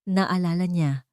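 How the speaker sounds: ordinary delivery, nothing marked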